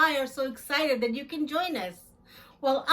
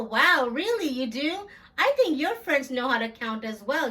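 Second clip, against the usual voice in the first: usual speaking voice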